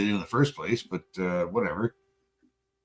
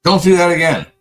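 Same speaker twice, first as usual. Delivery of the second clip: stern note to self; 5 semitones higher, 10 dB louder than usual. Real, not acted. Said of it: "note to self".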